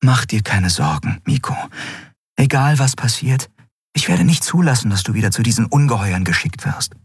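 mildly determined reassurance; protective friend. Acted. Figure reassures Miko.

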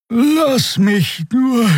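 drawn out very slow and high, fading into a yawn; "Lass mich nur" stretched lazily as a yawn takes over; sleepy, about to yawn. Acted. Tired healer waving Endriel off, yawning through "Lass mich nur". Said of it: "Ein Gähnen unterbrach sie".

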